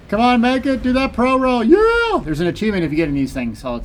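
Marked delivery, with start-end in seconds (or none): 0.1-1.6 high, loud urging on; excited cheering. Real, not acted. Cheering a risky roll. 1.6-2.2 high, loud burst of joy; triumphant yell. Real, not acted. Roll succeeds, cheer.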